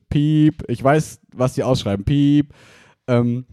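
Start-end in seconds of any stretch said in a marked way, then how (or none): 0.1-0.6 mock bleep sound saying piep; spoken censor beep. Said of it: "Ist das ein firmeninternes?". 2.1-2.5 mock bleep sound saying piep; spoken censor beep. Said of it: "Ist das ein firmeninternes?".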